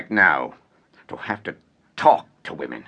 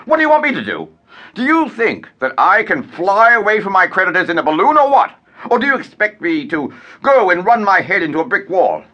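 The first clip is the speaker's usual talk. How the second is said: angry rant; 7 semitones higher than usual. Acted. Raging at widow.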